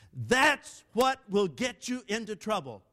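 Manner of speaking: "That's what will get you into trouble" high and loud, every word punched; emphatic word-by-word. Real, not acted. Preacher points out the danger again, each word hammered loudly. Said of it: "and emphasize it".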